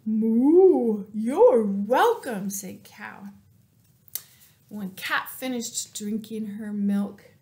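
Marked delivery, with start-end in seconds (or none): 0.1-2.5 loud, slow cow voice; mooing cow. Acted. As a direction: moo the words out. Cow answers Cat.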